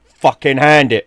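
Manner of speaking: loud, slow snarled order; furious dad. Retold or imitated. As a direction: bark it like an enraged father.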